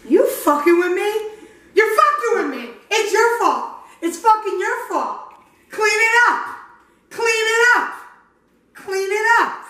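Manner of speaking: high-pitched, hammed-up telling-off; mock scolding. Host play-scolding over a flour mess, high mock outrage. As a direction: scold in a high, put-on voice, repeating the demand.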